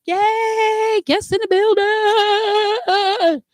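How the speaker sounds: slow, drawn-out high hype call; hype announcer. Acted. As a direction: stretch it out like a host introducing a star. Hosts hype up arriving guest.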